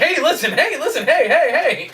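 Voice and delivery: high, repetitive mock pestering; pestering fairy-style call. Straitjacketed hero repeating the fairy's call, high pestering. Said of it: "it's him in the straitjacket".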